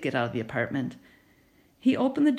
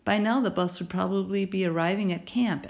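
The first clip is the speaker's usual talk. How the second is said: plain reading voice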